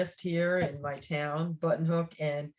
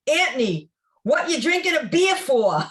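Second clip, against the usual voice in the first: louder, higher mimicry of a friend's yell; big mock-Brooklyn telling-off; imitating her Brooklyn scolding; 6 semitones higher, 6 dB louder than usual. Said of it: "this is what you told me".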